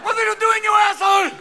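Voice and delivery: high, loud outburst of disbelief; outraged shout. Acted. TV network people reacting to the comedian, outraged shout. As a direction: yell it furiously, drawing out the curse. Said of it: "the people at H P O are going".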